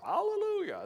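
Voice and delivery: high, held gospel "Hallelujah"; gospel choir caricature. Retold or imitated. Mocking the punchline hallelujah of gospel choirs, high choir-style parody. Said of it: "in gospel choirs".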